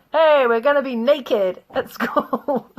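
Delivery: lightly voiced excited Spanish boys; imitating excited boys. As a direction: play the cheeky student announcing it. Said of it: "these two Spanish boys going".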